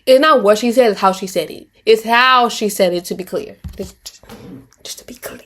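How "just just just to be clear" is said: whispered aside; hushed clarifying afterthought to viewers. Real, not acted. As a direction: drop to a breathy whisper for emphasis.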